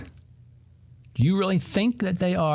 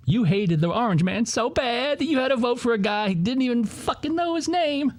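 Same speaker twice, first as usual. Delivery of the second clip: ticked-off rant; 9 semitones higher than usual. Real, not acted. Host ranting at voters, angry and rising. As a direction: pitch climbing much higher into an angry outburst. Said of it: "I'm just, I'm ticked off".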